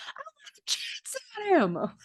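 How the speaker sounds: high squealing whine; shrill scream. Retold or imitated. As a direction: shriek it like a spoiled girl. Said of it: "Minerva screaming from the staircase".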